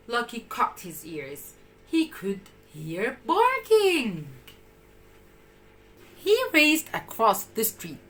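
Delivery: ordinary delivery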